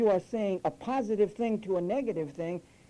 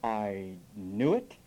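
slow, low mock "I knew it"; stretched-out imitation, rising at the end; mock knowing remark; 9 semitones lower, much slower than usual. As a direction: imitate a know-it-all, drawn out and low. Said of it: "This is the one I like".

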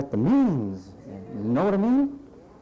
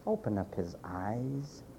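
soft prayer; 6 semitones lower, 9 dB quieter, slower than usual. Acted. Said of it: "said a simple prayer".

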